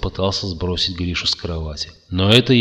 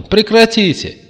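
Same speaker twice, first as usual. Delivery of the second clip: высокий громкий визгливый крик; завизжать, требуя прекратить; визг; 12 semitones higher, 9 dB louder than usual. A narrator's reading. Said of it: "завизжал он". (пронзительный визг)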